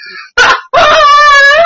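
high burst of helpless laughing; real laughter. Real, not acted. Telling his friend the raccoon story, real high laugh. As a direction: laugh out loud at the memory.

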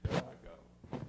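a near-silent breath as tears come; choked-up whisper. Real, not acted. Pastor to church, fighting tears, whispered. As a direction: caught by emotion, barely voiced. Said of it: "I'm trying not to cry".

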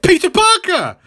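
excited high-pitched exclamation; Jessica. Retold or imitated. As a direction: squeal it in high-pitched recognition. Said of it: "Jessica's like".

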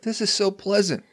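high, gushing mock delight; lightly hammed relief and joy. Praising the gentle new hygienist, high and gushing.

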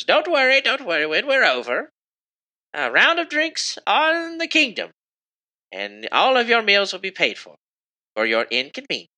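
very high-pitched queen proclaiming generosity; high royal voice addressing the crowd; elderly queen, reassuring. Acted. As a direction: announce it grandly in a high old-lady register.

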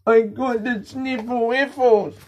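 high, slow goofy whimper; silly baby voice. Acted. Podcaster mocking his own sniffles, high slow baby whine. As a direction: play it like a whiny toddler. Goofing about his cold.

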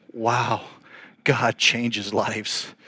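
soft, very slow wonder; hushed awe. Retold or imitated. Visitor looking around the church, quietly awestruck. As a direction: let it out slowly and softly in amazement. Awed reaction to a changed church.

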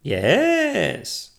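high, loud witch's yes; drawn-out, high-pitched cry of agreement. A narrator's reading. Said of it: "cried the witch".

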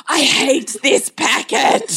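high, slow imitation of a cross child; mock tantrum. Mother voices her son joining in the throwing, mock tantrum. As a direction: drag out the complaint in hammed-up anger. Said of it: "was having a tantrum".

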